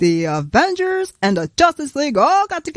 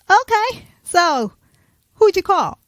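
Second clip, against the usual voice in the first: high, loud quizzing voice; Captain Marvel asking; 9 semitones higher, 4 dB louder than usual. Acted. Captain Marvel questioning the team, high and loud. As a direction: pitch it up as the heroine.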